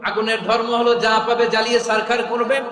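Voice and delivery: loud, high preacher voicing God's order; thundering divine command. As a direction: command the fire at full force.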